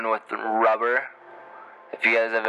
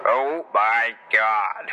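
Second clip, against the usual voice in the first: slow drawn-out groan; much slower than usual. Real, not acted. Reacting to the movie.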